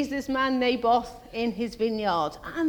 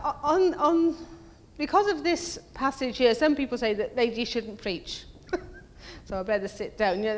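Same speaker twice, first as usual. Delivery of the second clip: ordinary delivery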